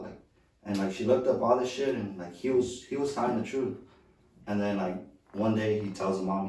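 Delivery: ordinary talk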